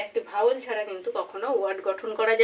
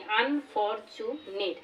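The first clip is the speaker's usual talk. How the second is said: Unfortunate very slowly, an, for, chu, net as four beats; four-syllable breakdown; much slower than usual. Shown as a demonstration. Teacher counting out four syllables, unfortunate slowly split as an-for-chu-net. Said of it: "আমরা চারবারে উচ্চারণ করতে পারছি".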